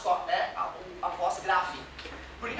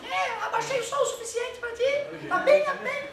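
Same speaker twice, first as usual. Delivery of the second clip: much higher pitched, laughing through it; squeaky high voice; 13 semitones higher than usual. Shown as a demonstration. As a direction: jokingly turn the sound down by speaking very high. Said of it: "Abaixar o som seria falar agudo". (voz bem aguda, rindo)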